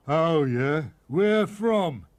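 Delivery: loud wolf voice; the wolf grows curious; gruff wolf. A narrator's reading. Wolf tempted.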